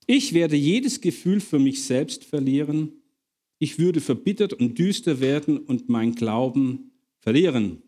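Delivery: ordinary talk, nothing marked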